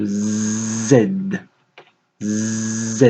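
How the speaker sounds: Z with a long buzzing start; drawn-out letter. Shown as a demonstration. Teacher sounding out a letter for pupils, long buzzed Z. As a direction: hold the zzz before finishing "Z".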